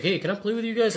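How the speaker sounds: high, sweet, hopeful ask; all nice. Retold or imitated. Richie politely asking kids to play, high and sweet. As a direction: ask the kids as nicely as possible. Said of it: "Like, all nice".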